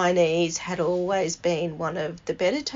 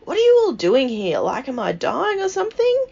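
retold outburst, higher and louder; bursting out; 8 semitones higher, 5 dB louder than usual. Nan to visiting family at her bedside, bursting out. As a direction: imitate Nan blurting an indignant question. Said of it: "she just burst out".

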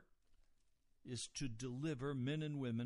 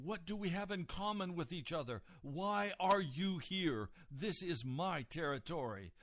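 raised, forceful territorial challenge; indignant protest; 5 semitones higher, 4 dB louder than usual. Retold or imitated. Evil spirit tells Jesus to leave its territory, indignant. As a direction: imitate a spirit indignantly staking its claim. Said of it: "is an expression of indignation".

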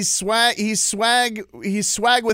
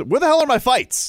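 raised, high-pitched complaint; indignant demand; 8 semitones higher, 4 dB louder than usual. Real, not acted. Calling out the promoter over promised fights, indignant demand. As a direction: demand it loudly, pitched well up.

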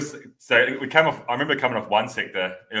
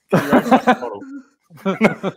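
laughing; 13 semitones higher, 6 dB louder, much slower than usual. Real, not acted. Laughing through story.

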